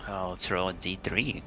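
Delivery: ordinary talk, nothing marked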